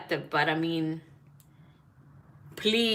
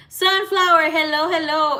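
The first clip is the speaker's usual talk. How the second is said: excited greeting; 9 semitones higher, 7 dB louder than usual. Real, not acted. Greeting viewers joining the stream.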